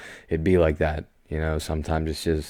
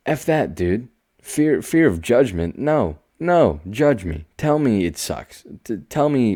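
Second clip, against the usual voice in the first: defiant emphasis; 6 semitones higher than usual. Real, not acted.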